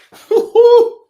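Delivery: very high, loud celebratory laugh; gleeful high cackle; delighted whooping laugh. Real, not acted. Delighted whoop at pitcher's return.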